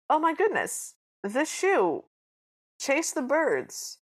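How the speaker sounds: high, drawn-out mock amazement; astonished prince. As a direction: exclaim it slowly as an awestruck prince. Acts out astonished prince in story.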